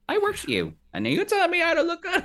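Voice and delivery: high-pitched, put-out protest; mock indignation. Drag contestant griping at friends who judge her, mock indignant.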